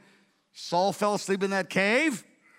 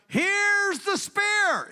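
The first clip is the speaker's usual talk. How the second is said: high, very slow call, holding up the prize; David taunting from afar; 12 semitones higher, much slower than usual. Retold or imitated. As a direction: draw it out as if shouting across a valley. David calls across the valley.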